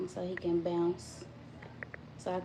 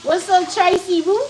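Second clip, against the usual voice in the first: loud greeting; 7 semitones higher, 6 dB louder than usual. Real, not acted. Greeting friend in chat.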